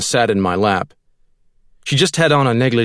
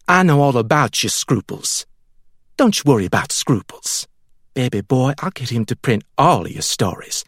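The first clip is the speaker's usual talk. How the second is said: reassuring Millie; 2 semitones higher than usual. A narrator's reading. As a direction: soothe him, you can fix it.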